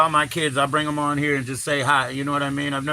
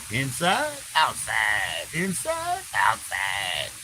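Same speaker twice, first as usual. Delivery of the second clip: sing-song pitch swings; 5 semitones lower, 4 dB quieter, much slower than usual. Real, not acted. Playful chant going in and out.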